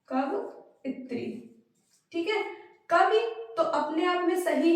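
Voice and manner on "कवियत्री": कवियत्री drawn out very slowly and softly; very slow, soft pronunciation. Shown as a demonstration. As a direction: dictate कवियत्री slowly and quietly. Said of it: "यहाँ पर कवियत्री होगा".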